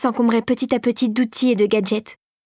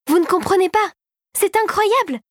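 much higher, eager insistence; insisting; 9 semitones higher than usual. A narrator's reading. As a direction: push him to understand, excited. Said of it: "insista sa protégée". (insistance enthousiaste, voix plus aiguë)

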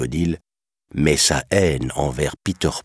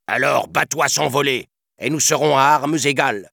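furious captain; 9 semitones higher than usual. A narrator's reading. Captain daring Peter to fight fairly, furious. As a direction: spit the dare with fury. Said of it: "ivre de fureur".

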